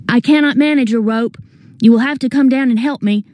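high, urgent cries for rescue; panicked pleading. A narrator's reading. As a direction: plead from peril with pitch held high. Hanging, begging for rescue.

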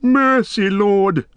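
high, grovelling plea; whining. A narrator's reading. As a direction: whine for mercy at the lord's feet. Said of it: "whined Wormtongue".

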